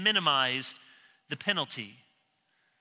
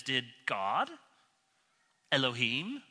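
sly Satan; 6 semitones lower, 5 dB quieter than usual. Retold or imitated. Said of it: "when Satan talks, he just says".

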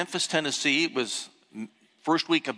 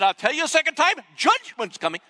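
much higher and louder, a ringing revival cry; preaching shout; 13 semitones higher, 4 dB louder than usual. Retold or imitated. Billy Graham repeating his warning to the stadium, ringing shout. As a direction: belt it out like a preacher riding the thunder. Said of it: "he said in his North Carolina accent".

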